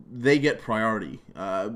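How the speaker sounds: ordinary talk, nothing marked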